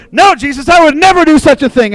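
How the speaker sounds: brash denial, brushing the idea off; mock confident. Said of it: "he becomes Mister Confident".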